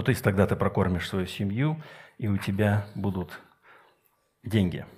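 ordinary talk, nothing marked

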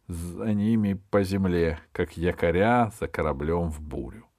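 ordinary delivery

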